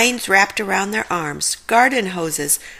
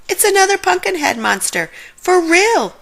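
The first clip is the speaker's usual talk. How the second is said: very high, louder at the end; Shaggy screams at the monster; terrified yell; 11 semitones higher, 4 dB louder than usual. Acted. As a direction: yell it in real panic. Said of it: "Shaggy yelled".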